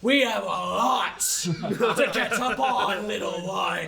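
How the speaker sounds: villain mocking the little one, slow and gloating; menacing villain. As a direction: savour the threat slowly. Villain taunts the party.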